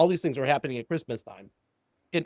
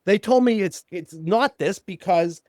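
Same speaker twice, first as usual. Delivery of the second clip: higher, louder retort; imitated huffiness; 7 semitones higher, 3 dB louder than usual. Client challenging his therapist, retold as huffy. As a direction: push back with mock indignation, pitch climbing. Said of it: "he got all huffy".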